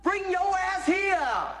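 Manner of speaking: slow, high stretched-out shout; yelling across the room. Acted. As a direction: holler it down the hall. Yelling for the chicken thief.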